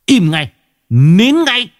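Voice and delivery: rising angry bark to silence the wailing; barked order. Acted. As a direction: shut her up with a sharp yell. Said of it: "Lão hất tay ra mà quát".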